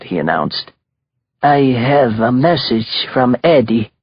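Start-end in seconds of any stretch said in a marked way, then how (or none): 1.4-3.9 put-on slow, heavy Italian accent; thick Italian accent. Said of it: "in a thick Italian accent".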